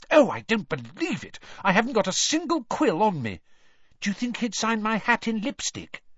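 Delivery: high, breathless fangirl gush; gushing girl. A narrator's reading. Sixth-year girl gushing to her friends over Krum. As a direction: squeal like a starstruck schoolgirl.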